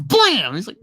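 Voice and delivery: ordinary talk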